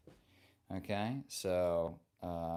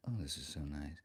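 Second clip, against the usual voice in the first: soft, low-pitched voice close to the mic; playful hushed low tone; low murmur; 8 semitones lower, 8 dB quieter, faster than usual. Pretend. Playing close with the new microphone.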